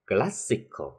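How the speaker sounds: "classical" punched on its first syllable, CLAS; stress on CLAS. Shown as a demonstration. Teacher drilling the stress rule, CLAS stressed in "classical". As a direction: hit the start of "classical", CLAS-si-cal. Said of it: "cách nó một vần về phía trước".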